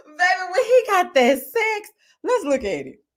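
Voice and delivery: half-crying with laughter, slowed down; laughing so hard it cracks up. Real, not acted. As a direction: talk through a high laughing shout. Laughing at Habibi's sax.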